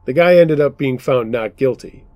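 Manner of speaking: plain reading voice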